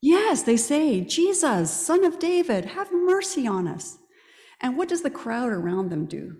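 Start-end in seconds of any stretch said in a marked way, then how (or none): 1.0-4.0 high pleading call; a raised plea for mercy; crying out. Retold or imitated. Blind men crying out to Jesus.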